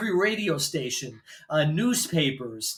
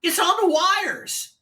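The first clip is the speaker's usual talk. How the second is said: slow, much higher DJ drawl; disc jockey voice; 12 semitones higher, slower than usual. Retold or imitated. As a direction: stretch it out like a radio host. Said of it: "disc jockey sounding voice".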